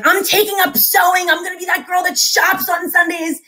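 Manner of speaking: high, emphatic mock declaration; mock resolve. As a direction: announce it like a grand fed-up vow. Quoting her fed-up past resolve.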